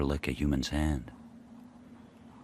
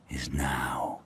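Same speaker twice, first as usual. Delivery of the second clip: tense whisper; 5 semitones lower, 7 dB quieter than usual. Acted. Tense standoff with Spitz.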